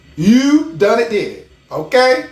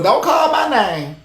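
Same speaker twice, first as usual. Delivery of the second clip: usual speaking voice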